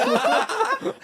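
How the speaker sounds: very high, loud imitated squeal; schoolgirl squeal. Retelling the moment back at the car, schoolgirl squeal. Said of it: "I squealed like a schoolgirl".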